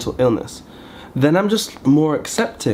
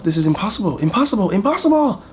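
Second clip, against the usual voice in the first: each impossible climbing much higher; rising exasperation; 9 semitones higher than usual. Acted. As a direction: build from normal to a desperate high squeak. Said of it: "I'm thinking to myself".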